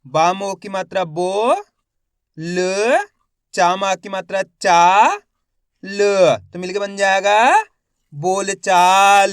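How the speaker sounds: slow spelling drill, each syllable held with a rising end; stretched syllables for beginning readers; letter-by-letter sounding out. Shown as a demonstration. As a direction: sound out each letter, then blend the word. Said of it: "एक बार फिर से देखिए".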